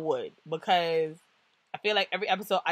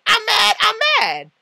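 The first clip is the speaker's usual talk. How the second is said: loud, high-pitched insistent venting; mock angry outburst; 13 semitones higher, 9 dB louder than usual. Acting out venting in the confessional, loud mock anger.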